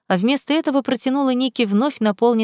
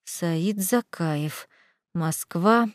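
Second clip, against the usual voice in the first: slow, softer word-by-word mutter; murmured to oneself; 3 semitones lower, 6 dB quieter, much slower than usual. A narrator's reading. Аля types his name into the search, murmuring slowly. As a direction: say it under your breath as you type. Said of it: "приговаривая".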